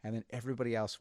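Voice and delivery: usual speaking voice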